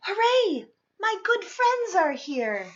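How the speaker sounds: high, loud happy cheer; joyful shout of welcome; excited cheer. Acted. Amos greets friends joyfully.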